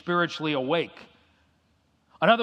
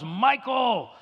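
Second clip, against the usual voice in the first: mother's mock yell, pitched up high; retold yell; 6 semitones higher than usual. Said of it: "and she yells".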